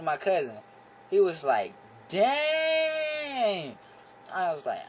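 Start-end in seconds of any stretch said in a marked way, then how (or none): 2.1-3.8 high shout of surprise; sudden high yelp; shocked dang. Retold or imitated. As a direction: blurt it out, stunned. Cousin's excited reaction.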